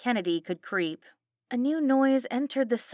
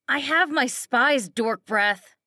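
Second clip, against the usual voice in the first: higher, loud sneering taunt; bully the kid like a spiteful mom; coked-up mom taunting her daughter at the wheel; mocking drunk mother; 5 semitones higher, 5 dB louder, slower than usual. A narrator's reading. Christy taunting.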